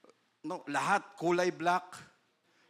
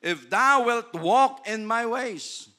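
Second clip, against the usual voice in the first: slow, loud solemn verse; verse posed as the question, slow; slow scripture; 5 semitones higher, 9 dB louder, much slower than usual. Acted. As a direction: weigh each word. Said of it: "ito ang question".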